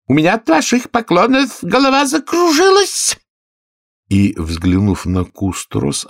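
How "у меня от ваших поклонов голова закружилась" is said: high, shrieking royal complaint; screamed complaint. A narrator's reading. Queen screams at her bowing subjects, high-pitched. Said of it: "завопила королева".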